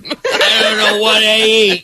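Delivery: laughing. Real, not acted. Laughing disbelief at plant eater.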